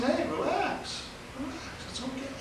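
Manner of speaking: high, very soft calming voice; soothing reassurance. Retold or imitated. Truck owner to flustered driver who backed into him, acted soothing. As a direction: gently talk someone down, light and hushed.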